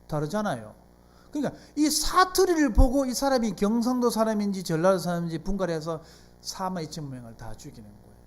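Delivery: normal talking voice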